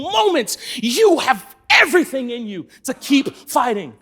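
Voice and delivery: rally the congregation to keep fighting; fiery sermon climax; preaching at full force. Acted. Pastor building to exhortation.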